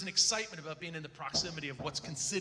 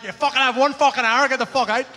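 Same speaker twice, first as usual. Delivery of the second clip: loud, higher threatening shout; threatening yell; 7 semitones higher, 17 dB louder than usual. Retold or imitated. Local heavies yelling at him to leave within the hour, loud and threatening. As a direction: bark the order to clear out.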